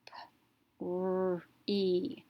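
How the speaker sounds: P, r, e as separate sounds, long vowel; phonics-style P, held r, long e; sounded out. Shown as a demonstration. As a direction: sound out P, r, e, stretching e as "ee". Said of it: "gonna make a long vowel sound".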